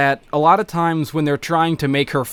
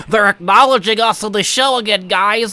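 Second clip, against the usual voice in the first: higher, hammed-up triumphant cheer; mock excited; 7 semitones higher than usual. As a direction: gloat like you made it big. Mock celebrating being noticed by show.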